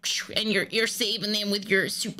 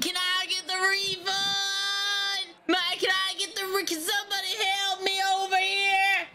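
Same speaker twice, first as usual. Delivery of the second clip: whiny wailing wife; 9 semitones higher than usual. Acted. Wife begging the bank for her refund, high whining wail. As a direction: wail like a helpless child at the counter.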